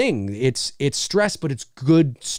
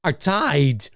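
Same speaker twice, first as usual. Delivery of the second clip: halting impression with odd stretched words; Christopher Walken; slower than usual. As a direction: break the line up oddly, drawing words out slowly. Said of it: "in the Christopher Walken voice".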